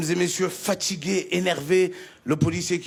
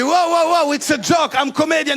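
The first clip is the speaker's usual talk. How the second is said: louder, higher panicked protest; 5 semitones higher, 4 dB louder than usual. Retold or imitated. Comedian panics at the guard, loud higher protest. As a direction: back off the guard quickly. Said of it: "Je lui ai dit".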